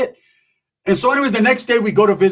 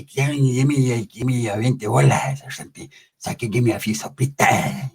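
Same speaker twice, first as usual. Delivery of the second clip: low raspy inmate voice; 5 semitones lower, 4 dB quieter than usual. Acted. Pepe voiced low and soft.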